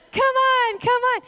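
high, loud sing-song coaxing, as if calling a pet. Retold or imitated. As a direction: lure a scared bird down with a high call. Luring bird toward nectar.

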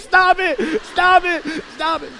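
high-pitched tantrum cries, fading out; mad child. Acted. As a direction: act a kid lashing out in a high voice. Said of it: "So I get so mad".

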